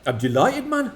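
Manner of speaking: put-on a light, amateur Geordie accent; a son asks his dad for a Geordie accent, mock Geordie lad. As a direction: put on a hammed-up Geordie lad's voice. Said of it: "you'd do your Geordie accent".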